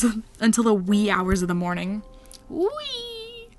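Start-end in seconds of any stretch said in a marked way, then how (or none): 2.5-3.5 high squeal. Real, not acted. Playful squeal on narration pun.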